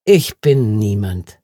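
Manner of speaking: slow, low, dry statement. A narrator's reading. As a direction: state it drily and unhurriedly. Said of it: "sagte Ben trocken".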